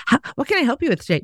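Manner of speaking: high, very quick sales opener; rote retail greeter. Acted. Clerk approaches a browsing customer, high and hurried scripted greeting.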